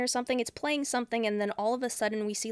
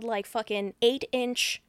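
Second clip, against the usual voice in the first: usual speaking voice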